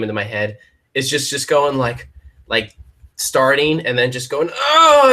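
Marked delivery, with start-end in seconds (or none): 4.5-5.1 high, loud pretend gasp; a hammed-up yelp as the pencil snaps; mock gasp. Pretending to fail a drawing challenge, mock gasp.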